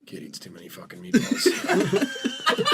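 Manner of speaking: breathy, squeaked, slow mock dad grumble; a laughing parody of an annoyed father; mock pissed dad. Dad behind the newspaper, mock annoyed in a laughing squeak. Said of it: "he's pissed and he's like".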